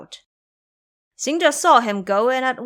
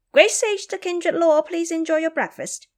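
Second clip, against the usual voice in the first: much higher and louder, eager little monkeys; squeaky monkeys calling out their greeting; 9 semitones higher, 3 dB louder than usual. A narrator's reading. Little monkeys loudly greeting the great sage with fruit. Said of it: "they said loudly".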